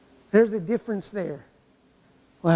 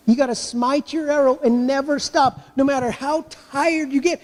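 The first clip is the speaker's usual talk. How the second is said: usual speaking voice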